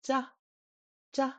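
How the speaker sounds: "ja" close to a J, no puff of air; softest CH. Shown as a demonstration. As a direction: say "ja" with an unaspirated ch. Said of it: "like the softest C H".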